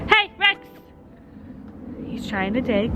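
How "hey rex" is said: calling out. Real, not acted. Calling the dog.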